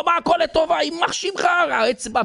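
high-pitched pained outrage, played up; mock angry screaming. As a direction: screech it like an injured, furious king. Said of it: "והוא צועק, צורח". (צעקת זעם וכאב מוצגת, בקול גבוה)

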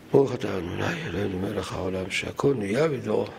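low, subdued recitation of a blessing; murmured blessing. Real, not acted. Recites a blessing before drinking.

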